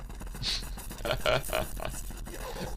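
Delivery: a quiet airy laugh under the breath; soft breathy laugh. Real, not acted. Laughs at Discord teasing.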